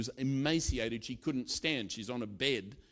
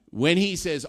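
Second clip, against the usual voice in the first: ordinary talk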